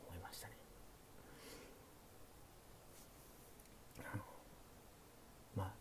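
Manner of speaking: hushed late-night chat, often whispered; sleepy. Pretend. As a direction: talk softly like the listener is drifting off. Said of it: "寝落ち配信なんでね". (小声でささやく寝落ち配信トーク)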